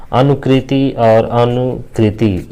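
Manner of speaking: slow अनुकृति और अनुकृति; two options read slowly. Shown as a demonstration. Teacher reading exam options, slowly. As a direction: lay out both spellings slowly. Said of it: "अनुकृति और अनुकृति विकल्प में दिया है".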